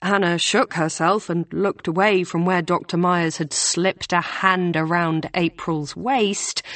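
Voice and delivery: plain reading voice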